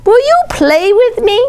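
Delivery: put-on high, eager little-girl plea; girl begging the frog to play, high and eager; little girl asking. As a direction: call to the frog like a small girl wanting a playmate. Girl asks turtle to play.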